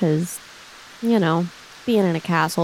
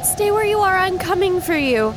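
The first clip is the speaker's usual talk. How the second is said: high, raised urgent call; calling out as Thea; 11 semitones higher than usual. Acted. Thea calling to the figure by the river. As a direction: shout reassurance across the storm.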